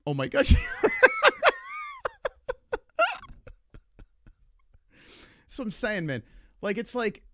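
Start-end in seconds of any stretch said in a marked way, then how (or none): 0.6-3.5 high squealing laugh, real and loud; burst of laughter. Real, not acted. After the tense argument, high squealing laugh.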